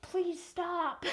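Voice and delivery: high, laughing plea; exasperated plea. Real, not acted. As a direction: beg him, half laughing. Exasperated plea to repetitive character.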